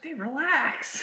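high, drawn-out mock plea; hammed-up exasperated appeal. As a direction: stretch it out slowly in high-pitched exasperation. Acted plea to a frantic gym-goer.

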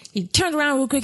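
ordinary talk

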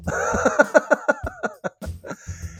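breathy unvoiced laugh; airy laugh. Real, not acted. Host laughing at silent Ryan, breathy.

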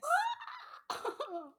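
squeaky emotional laugh; high teary laugh. Real, not acted. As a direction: laugh out high, close to tears. Moved by friend's words.

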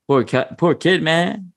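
usual speaking voice

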